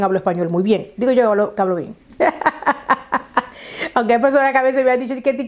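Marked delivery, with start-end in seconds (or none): 2.2-3.4 higher-pitched, chuckling run of laughter; amused laugh. Real, not acted. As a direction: laugh at your own boast. Laughs at her own boast.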